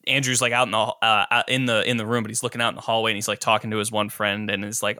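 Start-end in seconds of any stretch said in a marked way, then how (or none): none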